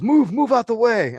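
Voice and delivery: bossy "move" thrown at someone in the way; mimicking a rude gym manager; imitated bark. Manager shoving past, ordering.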